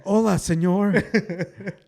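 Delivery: slow, hammed-up Spanish-accented English, laughing; thick Mexican accent. Joking about Tesla jobs, mock thick Mexican accent. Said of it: "speak with a, a thick accent".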